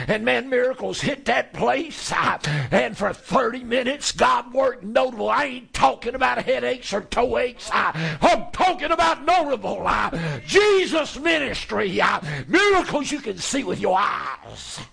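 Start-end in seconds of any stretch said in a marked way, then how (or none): 5.3-14.8 shouted, rising preacher's climax; fiery preaching. Real, not acted. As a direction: build to a hollering peak. Preaching at full force.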